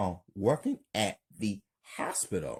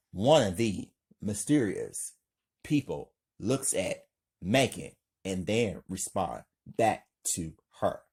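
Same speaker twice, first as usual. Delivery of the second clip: usual speaking voice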